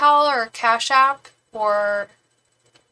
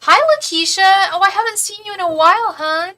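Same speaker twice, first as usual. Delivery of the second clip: bright, high, loud hello to a regular; light up greeting a familiar viewer; excited greeting; 9 semitones higher, 7 dB louder than usual. Real, not acted.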